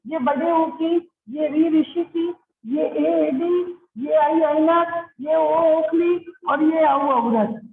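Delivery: slow, spelled-out vowel list; teacher chants the vowel chart slowly; slow recitation. Shown as a demonstration. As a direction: drill each vowel slowly for children. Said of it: "teacher reciting vowel signs".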